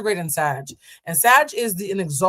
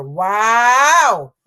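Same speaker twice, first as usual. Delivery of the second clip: loud drawn-out disbelief; stunned wow; 3 semitones higher, 9 dB louder than usual. Real, not acted. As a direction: let it hang in disbelief. Reacting to ex's rude reply.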